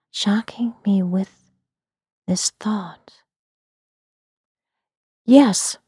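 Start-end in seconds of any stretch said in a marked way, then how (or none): 5.3-5.8 louder, quick yes; quick answer. Acted. Narrator answering the Kaliak on the bench, quick reply. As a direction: answer at once, with a lift. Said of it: "I said quickly".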